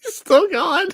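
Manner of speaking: high, gleeful and amazed; delighted can't-believe-it; excited. Real, not acted. Gleeful disbelief at show.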